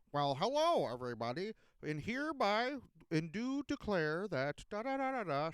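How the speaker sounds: lightly mocking stiff robot voice; robotic mayor. A hint of a robotic mayor mocking him. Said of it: "he's like a robot".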